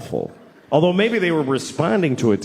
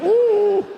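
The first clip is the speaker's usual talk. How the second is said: high, whining ooh; mock sad dog moan; pitiful dog whimper; 3 dB louder than usual. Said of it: "and he's like".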